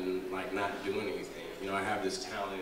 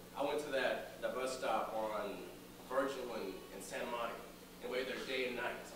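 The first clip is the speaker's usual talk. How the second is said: higher, impassioned stage delivery with long pauses; dramatic monologue; 6 semitones higher than usual. Acted. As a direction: recount a lost love to an audience, pausing for weight.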